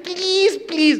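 mock girlish begging; high pleading. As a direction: beg in a high sing-song. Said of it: "And she said".

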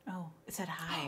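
hushed whisper. Real, not acted. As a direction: whisper so nobody hears. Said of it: "Why are we whispering?".

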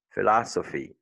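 "philosophy" with a hissed S in the middle; S sound in philosophy. Shown as a demonstration. Teacher modelling for French learners, "philosophy" with S, not Z. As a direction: keep the S of "philosophy" voiceless. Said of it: "S entre deux voyelles, c'est pas toujours Z".

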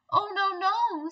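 high, quick no-no from a wary little fly; lightly voiced fly, politely backing away; little fly refusing. Fly turning down the spider's offer, high and wary. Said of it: "said the little fly".

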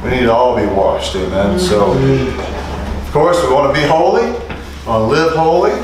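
ordinary talk, nothing marked

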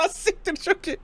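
high, laughing amazement; real cracking-up laughter in the voice. Real, not acted. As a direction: burst out through laughter. Laughing at the clip.

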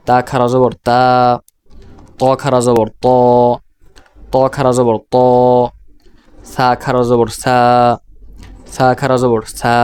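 closing তা, ত, সা each held long; long madd vowel. Shown as a demonstration. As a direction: recite the spelling, then stretch the final তা, ত or সা. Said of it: "এইভাবে".

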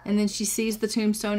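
usual speaking voice

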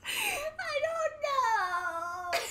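whiny wail. Real, not acted. Panicked in game.

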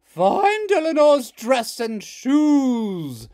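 slow, very loud mother; rumbling. Acted. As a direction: order the kids from above. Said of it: "rumbled Hazel's mother".

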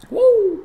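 loud, very high "woo"; high cheering whoop. Real, not acted. Cheers a gift on stream.

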